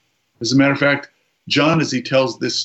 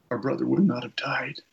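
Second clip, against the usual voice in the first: whispered, breath only, much softer; grieving whisper; 7 dB quieter than usual. Retold or imitated. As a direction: let the words die away to a broken whisper. Mary's grief trails into a whisper.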